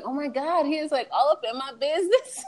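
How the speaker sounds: high, nervous inner voice. Retold or imitated. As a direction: retell it in a high-pitched fluster. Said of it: "I was so nervous".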